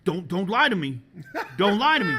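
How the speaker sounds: mock-stern warning shout; imitated angry accusation. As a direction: act out a teacher not buying it. Imitating angry teacher accusing a student.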